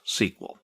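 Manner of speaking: "sequel" said as one word, SEE-kwul; sequel pronunciation. Shown as a demonstration. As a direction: pronounce "sequel" as a word in place of the initials. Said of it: "S Q L is usually pronounced".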